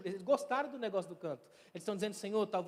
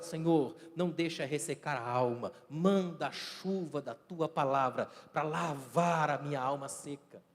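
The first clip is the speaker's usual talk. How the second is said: lower, slow heartfelt prayer; earnest prayer; 5 semitones lower, slower than usual. Acted. Preacher leading the church in prayer, lower and earnest. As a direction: pray it humbly, stretching the words. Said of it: "a gente ora logo agora".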